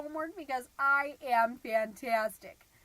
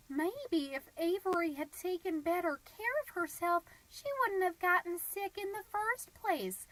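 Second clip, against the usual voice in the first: higher-pitched, sanctimonious imitation; judgmental Heather; 6 semitones higher than usual. Heather passing judgement on Avery's illness, judgmental. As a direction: pitch it up, faintly blaming.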